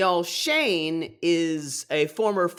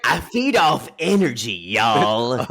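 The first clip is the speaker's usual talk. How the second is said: loud, drawling mock impression; Shane as Gary Busey; 2 semitones lower, 6 dB louder than usual. Co-host doing contestant in actor's voice, hammed-up drawl. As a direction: ham up the catchphrase in character. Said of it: "my Shane voice is also my Gary Busey".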